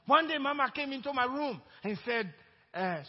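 loud, high, fervent preaching; preaching at full force. Real, not acted. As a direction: preach it out to the congregation. Preaching climax of testimony.

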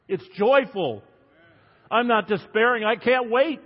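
high, loud and jubilant preaching; joyful exultation. Real, not acted. Preacher tells the congregation of his hope, joyfully exultant. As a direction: proclaim it with rising excitement.

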